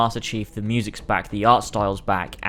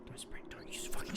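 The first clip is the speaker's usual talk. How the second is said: whisper; 18 dB quieter, faster than usual. Real, not acted. Dreading sprint in gameplay reveal.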